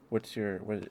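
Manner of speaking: normal talking voice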